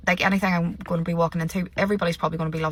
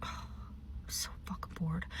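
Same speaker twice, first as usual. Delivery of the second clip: whispered and much softer, worn out; bored whisper; 18 dB quieter than usual. Real, not acted. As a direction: breathe it out as if fed up with all of it. Weary aside after sighing.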